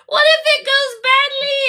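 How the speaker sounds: high, stammering hammed-up worry; mock anxious character. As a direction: imitate her fretting in a high voice. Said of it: "And she's like".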